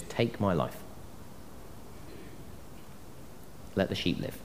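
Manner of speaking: soft, pausing offer of one's life; quiet surrender. Acted. Shepherd to the wolf when push comes to shove, quiet. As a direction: say it softly, with long pauses.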